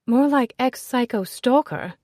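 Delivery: loud, high, teasing aristocratic friend; brash society lady. Acted. Ezzy greeting Nicholas at the party, loud and teasing. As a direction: greet him with a bawdy, affectionate jab.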